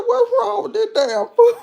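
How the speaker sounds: high, loud and laughing; laughing exclamation. Retold or imitated. Asking about the slumped lady, high and laughing. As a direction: laugh through the question in disbelief. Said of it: "I said".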